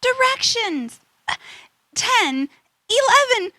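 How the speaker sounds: ordinary delivery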